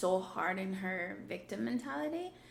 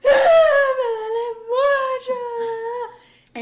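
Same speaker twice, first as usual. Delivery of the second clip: very high, loud drawn-out wail; hammed-up bawling in pain; mock crying mom; 15 dB louder, much slower than usual. Imitating mom once the car door shuts, loud mock wailing. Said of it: "she's crying about how much".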